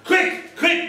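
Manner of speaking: high-pitched urgent screech; high, hurried screech. Acted. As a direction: screech it like a frantic mum. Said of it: "Mum screeched".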